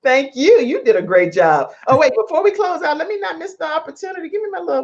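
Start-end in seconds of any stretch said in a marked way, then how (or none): 1.9-4.8 excited high. Real, not acted. Sudden remembering, excited.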